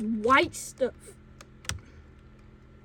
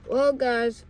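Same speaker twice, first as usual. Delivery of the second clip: ordinary delivery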